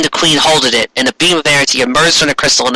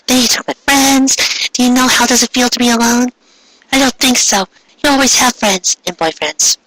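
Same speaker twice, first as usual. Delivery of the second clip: put-on higher, resentful girl voice; lightly voiced jealous sister; bitter sister; 10 semitones higher than usual. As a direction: spit out her grudge, higher.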